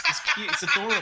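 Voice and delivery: squealing high with delight; delighted high-pitched gush. Real, not acted. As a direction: coo over it like a puppy. Gushing about Rocky cooking video.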